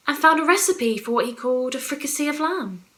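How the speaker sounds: ordinary talk, nothing marked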